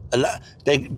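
ordinary delivery

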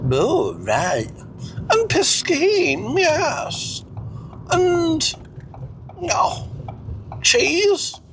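high-pitched, drawn-out struggle for French words; Cecil, fussy would-be French speaker. Acted. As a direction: grope for French in a high, slow character voice. Plays Cecil.

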